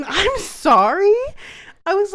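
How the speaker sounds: laughing through the words, high and loud; giggling apology mid-story. Real, not acted. Laughing while telling story.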